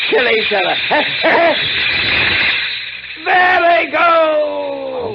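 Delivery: high, cackling laugh breaking into a slow shout; gleeful laugh and shout. Acted. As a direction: crow with delight as the rats pile up. Gloating over rats killing each other.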